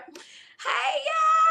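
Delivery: very high, stretched-out hello; squealed greeting. Real, not acted. Host hailing all the viewers, squealing excitedly. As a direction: burst out with a delighted shriek of hello.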